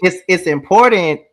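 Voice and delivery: emphatic interjection. Real, not acted. Second speaker cuts in forcefully.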